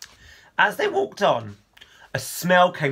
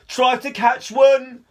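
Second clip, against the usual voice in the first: high, louder, slower call from the bank; 9 semitones higher, 6 dB louder, slower than usual. Acted. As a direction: shout across the water. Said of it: "called Con".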